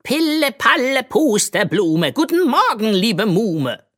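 a silly magic hat proclaiming; talking hat. A narrator's reading. Enchanted hat greeting the girl, high silly chant. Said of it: "verkündete".

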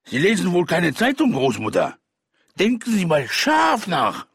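loud, high, jeering villain voice; mocking robber. A narrator's reading. Robber laughing at grandmother's ignorance, mocking. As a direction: taunt her as a boisterous robber.

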